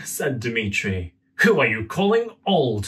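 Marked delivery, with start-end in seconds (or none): 1.4-2.9 snapped. Acted. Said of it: "snapped the man".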